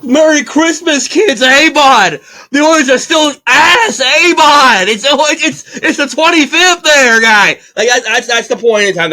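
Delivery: a cheerful Alberta hockey fan's yell; Alberta accent. Acted. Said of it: "That's my best Alberta accent".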